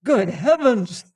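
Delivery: appalled outcry; shocked exclamation. Acted. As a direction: exclaim in horror. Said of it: "he exclaimed".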